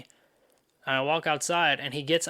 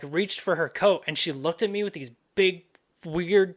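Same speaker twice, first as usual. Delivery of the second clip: usual speaking voice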